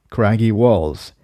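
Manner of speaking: ordinary delivery, nothing marked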